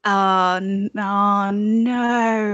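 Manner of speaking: reluctant mock French groan; hesitant French-accented dismay; horribly French accent. Shown as a demonstration. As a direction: lightly put on a bad French accent. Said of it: "horribly French accent".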